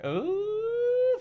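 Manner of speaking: plain reading voice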